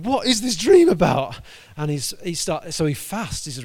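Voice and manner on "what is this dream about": high, loud, bewildered cry; startled waking. Retold or imitated. Man wakes and exclaims about the dream, high and loud. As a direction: wake up baffled and exclaim.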